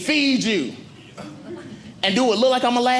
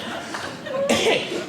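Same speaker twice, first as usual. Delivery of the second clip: high laugh; 3 dB quieter than usual. Real, not acted. Laughing at own joke.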